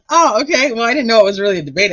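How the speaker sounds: surprised. Real, not acted. Surprised by debate news.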